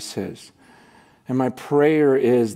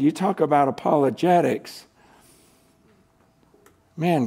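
ordinary delivery

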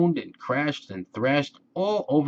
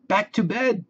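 mild storybook yell; 11 semitones higher than usual. A narrator's reading. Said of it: "yelled Ted".

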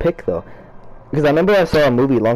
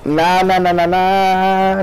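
slow stretched nah in disbelief; drawn-out denial; 5 semitones higher, much slower than usual. Real, not acted. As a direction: drag out each nah, refusing it. Denial after losing a round.

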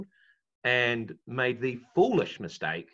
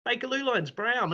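ordinary delivery

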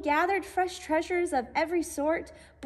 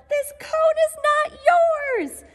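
loud high squirrel yell; shouting; 11 semitones higher, 8 dB louder than usual. A narrator's reading. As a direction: yell to claim the cone. Searle shouting in the squabble.

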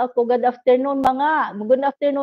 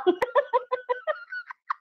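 very high squealing laugh; shrieking laugh; 6 dB quieter than usual. Real, not acted. Host teasing a hungover viewer breaks into a high squeal of laughter.

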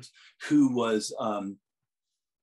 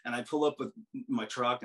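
ordinary talk, nothing marked

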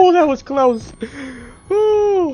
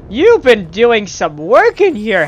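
ordinary talk

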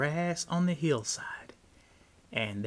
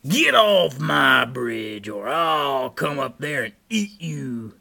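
troll's scream; 9 dB louder than usual. A narrator's reading. Troll threatens to eat Big Billy, loud yell. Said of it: "screamed the troll".

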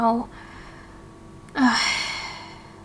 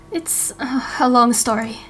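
ordinary talk

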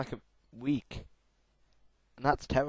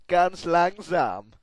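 slow German; 5 semitones higher, 7 dB louder, much slower than usual. Acted.

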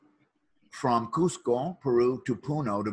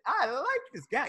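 delighted; 13 semitones higher, 3 dB quieter than usual. Retold or imitated. Delighted reaction to the builder's offer.

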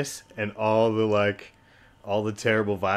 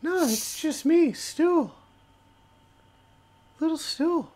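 tiny mushroom, very high squeak; Stool's little voice; slower than usual. Acted. Mushroom reassures someone hearing voices, tiny high voice. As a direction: introduce yourself in a small, high creature voice. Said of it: "this little voice in your head".

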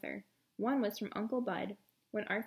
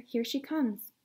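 plain reading voice

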